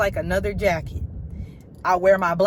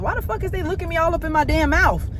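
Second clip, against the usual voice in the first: high, fast exasperated outburst; mock-outraged rant, higher and quicker; indignant quote; 8 semitones higher, 3 dB louder, faster than usual. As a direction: spit it out, baffled and offended. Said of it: "I be like".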